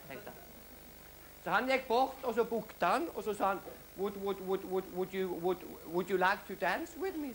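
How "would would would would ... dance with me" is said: stuttering ask. Acted. Stuttering boy asks girl to dance.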